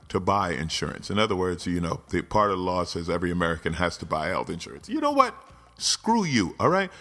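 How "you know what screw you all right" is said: exasperated outburst. Real, not acted. Ranting at healthcare law opponents, exasperated outburst. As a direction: snap at them in disgust.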